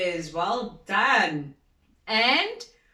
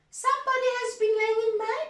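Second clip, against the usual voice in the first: much higher, quick mama bear; higher, faster mother bear; middle-sized bear voice; 14 semitones higher, much faster than usual. Acted. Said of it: "in her middle-sized voice".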